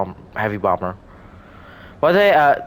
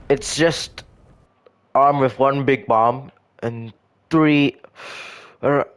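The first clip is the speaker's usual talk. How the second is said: talking through a yawn; words stretched out by a yawn; 3 semitones higher, much slower than usual. Real, not acted. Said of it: "I was yawning".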